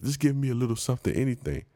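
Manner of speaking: mild mock pleading, quick; pushy seller. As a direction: rush the begging like a persistent hawker. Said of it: "when I say I don't need it".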